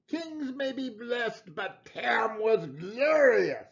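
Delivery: plain reading voice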